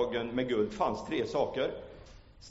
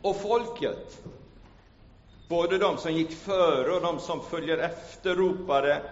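preaching Bible reading; 3 semitones higher, 8 dB louder than usual. Acted. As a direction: proclaim the Gospel to the church. Reads Gospel aloud in preaching voice.

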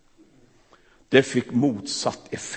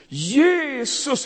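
loud, high, slow cry for mercy; 12 semitones higher, 6 dB louder, much slower than usual. Acted. The blind beggar calling to Jesus over the crowd, high and loud. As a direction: call out across the crowd, drawn out. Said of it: "han ropar så det ljuder".

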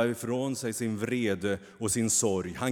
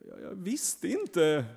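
higher, faltering sob-like plea; mild sobbing; 10 semitones higher, 5 dB louder than usual. A narrator's reading. Kain sobs his excuse to the angel, mild sobbing. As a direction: plead through tears. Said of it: "snyftar Kain".

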